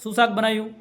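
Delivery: husband yelling at the wife he thinks is deaf; near shout. Retold or imitated. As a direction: shout it at her from close by. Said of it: "દસ ફૂટ દૂર રહીને બૂમ મારે છે".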